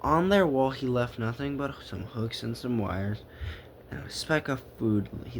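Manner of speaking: plain reading voice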